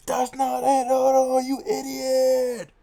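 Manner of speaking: very high, loud, drawn-out yell; furious acted shout, last words stretched slow; full-force scream. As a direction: scream it right into her ear. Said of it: "he's screaming in her ear".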